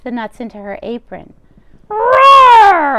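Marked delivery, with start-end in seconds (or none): 1.9-3.0 huge high, much louder roaring growl; bear roar. Acted. Old Bear roaring as she tugs his fur. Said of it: "Old Bear roared".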